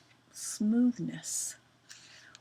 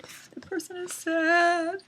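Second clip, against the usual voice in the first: acted sad; 9 semitones higher, 4 dB louder than usual. Shown as a demonstration. Teacher showing pupils how to act sad, exaggerated and high. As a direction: put on a big sad voice. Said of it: "then you act sad".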